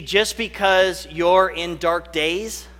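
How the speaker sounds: usual speaking voice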